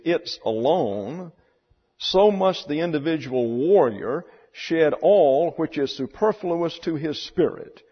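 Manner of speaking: measured, emphatic reading from a novel; reading aloud. A narrator's reading. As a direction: read the passage out with weight and deliberate pauses. Reads book passage aloud.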